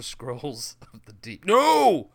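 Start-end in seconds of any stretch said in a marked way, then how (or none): 1.5-2.1 high, loud drawn-out "no"; mock outcry. Co-host playing up a game pun, mock outcry. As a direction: shout it in mock protest.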